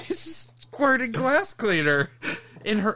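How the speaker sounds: high, loud laugh-talk; words bubbling through laughter. Real, not acted. Telling friend about the coworker, laughing high.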